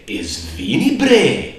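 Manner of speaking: sharp and loud, the bre flung up high; irritated high-pitched jab; annoyed retort. Shown as a demonstration. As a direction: snap it back at a smartass. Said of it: "if someone makes you angry or is being a smartass to you".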